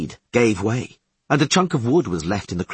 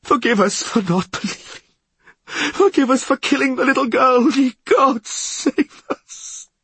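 high wailing prayer, breaking into breath at the end; sobbing plea; 4 dB louder than usual. A narrator's reading. Frightened man begging forgiveness from gods, high sobbing wail. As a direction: wail and beg for mercy. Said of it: "sobbed Kevin R. Van Vliet".

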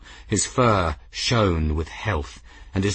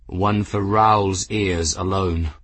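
plain reading voice

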